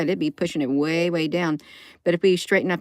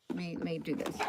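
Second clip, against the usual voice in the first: normal talking voice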